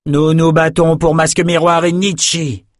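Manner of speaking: high, sustained battle chant; shouted in chorus. Acted. Four men in combat, crying out together. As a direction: proclaim it like a rallying cry. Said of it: "crièrent en chœur les quatre hommes".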